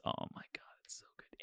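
hushed, breathy rapture over food; swooning whisper. Real, not acted. Raving about bang bang shrimp to a friend, swooning whisper. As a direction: drop into a reverent whisper.

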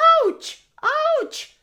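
high loud ouch cries; pained yelps. Acted. Stung tiger cries out, high loud yelps. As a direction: yelp as if stung.

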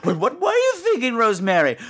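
high, amused genteel lady; prim British lady. Retold or imitated. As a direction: tease her friend sweetly. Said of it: "the conversations are always like".

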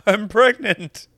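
high, loud, drawn-out mock cry; mock pregnancy announcement. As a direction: stretch it out high like a stunned discovery. Acts out homeless man finding the test.

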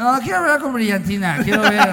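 prank caller. Pretend. Prank call voice continues.

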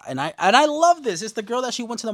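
loud, high burst of delight; excited gushing. Real, not acted. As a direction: gush with real enthusiasm. Gushes over favourite story arc.

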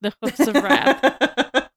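slow, loud, hammed-up epic line; mock grandiose. Shown as a demonstration. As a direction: overblown dramatic reading. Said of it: "I sound like I'm super joking".

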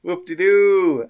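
high, loud, drawn-out cheer. Real, not acted. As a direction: whoop for a fellow player. GM cheers the player's good news.

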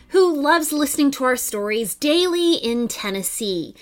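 ordinary delivery

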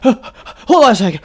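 gasping the words out after a hard swim; out of breath. Retold or imitated. Friend imitating exhausted buddy after swimming, breathless and slow.

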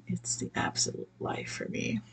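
breathy whispered aside. Real, not acted. Speaker dreaming of being pampered, whispered aside. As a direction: whisper it like a secret wish.

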